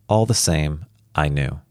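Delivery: plain reading voice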